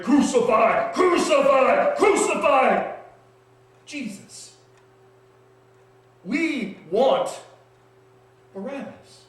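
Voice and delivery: high, loud mob chant; crowd screaming. Acted. Crowd screams for Jesus to die, loud high chanting. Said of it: "I heard the crowd scream".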